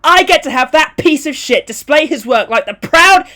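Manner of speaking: high, loud, outraged rant; yelled. Acted. Gallery owner ranting about displaying his work, yelled outrage. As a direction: rage on at a shout. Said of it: "yelled the owner".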